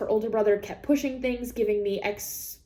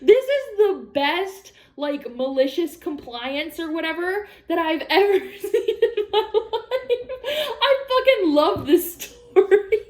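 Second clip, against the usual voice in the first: laughing delight; 7 semitones higher, 3 dB louder than usual. Real, not acted. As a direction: gush through the laughter. Laughing through her delight.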